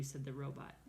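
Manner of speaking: usual speaking voice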